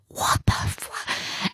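breathy stunned whisper; whispered disbelief. Retold or imitated. Friend whispers in shock inside the church, whispering.

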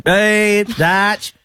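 a hint of Smithy's voice note; Smithy impression. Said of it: "a pretty good Smithy, Smith Tech impression".

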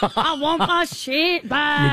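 put-on Irish accent. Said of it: "You're going Scottish".

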